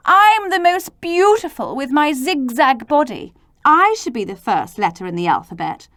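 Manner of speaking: boastful Zed. Acted. Zed boasts about his body.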